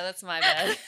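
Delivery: loud, laughing apology. Real, not acted.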